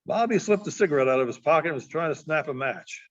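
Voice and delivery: plain reading voice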